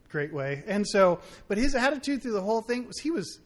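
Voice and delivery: usual speaking voice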